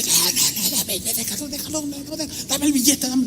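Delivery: high, hushed, rapid mock pleading; pleading girlfriend. Girlfriend stopping her boyfriend giving back the money, pleading. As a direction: imitate her tussling for it in a small high voice. Girl struggling for the banknote.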